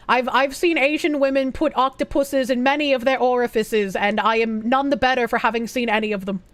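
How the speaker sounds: ordinary talk, nothing marked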